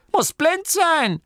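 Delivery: old grandmother mocking the abbot, high and loud; sniffy grandma. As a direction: sniff it out scornfully. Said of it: "schniefte Oma Morky".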